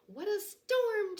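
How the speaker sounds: high, loud chirpy bird voice; twittering wren. Acted. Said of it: "twitters wren".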